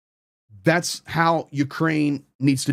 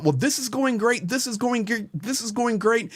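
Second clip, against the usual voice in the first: higher, sing-song mock optimism; mocking YouTuber cheer; 6 semitones higher than usual. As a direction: parrot the empty hype of online pundits. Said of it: "YouTubers out there talking about".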